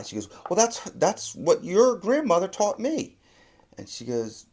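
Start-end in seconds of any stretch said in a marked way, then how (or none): 0.5-3.2 mother justifying herself. Retold or imitated.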